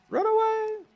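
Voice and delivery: high, panicked cry of run away; being chased. Acted. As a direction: yell it while fleeing. Being chased by enemies in game.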